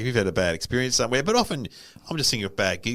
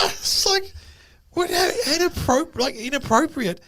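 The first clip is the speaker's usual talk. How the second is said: incredulous. Real, not acted. Shocked reaction.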